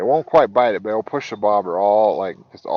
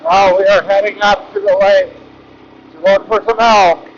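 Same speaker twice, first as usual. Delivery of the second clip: recorded over wind; 10 semitones higher, 12 dB louder than usual. Real, not acted. Narration over louder recording.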